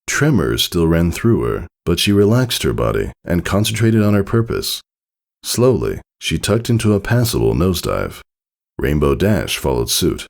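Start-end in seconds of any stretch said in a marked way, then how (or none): none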